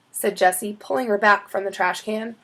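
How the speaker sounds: ordinary delivery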